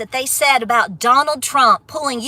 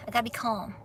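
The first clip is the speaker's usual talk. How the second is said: quiet self-calming; 5 semitones lower, 15 dB quieter, faster than usual. Real, not acted.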